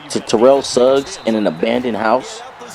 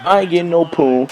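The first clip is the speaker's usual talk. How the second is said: irritated comeback; 7 semitones higher than usual. Retold or imitated. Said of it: "I'm like".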